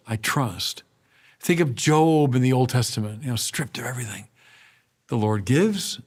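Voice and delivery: normal talking voice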